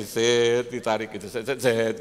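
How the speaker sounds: loud, sudden "set" sound effect; mimicked yanking sound. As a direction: imitate quick tugs with the voice. Mimics yanking of puppet strings. (menirukan bunyi tarikan)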